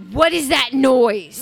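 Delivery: very loud, drawn-out mock complaint; irritated yell. Woman mimics partner woken by flat iron, irritated yell. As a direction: bellow it slowly as the woken partner.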